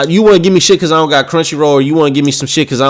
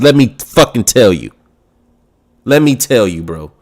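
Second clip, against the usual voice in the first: ordinary talk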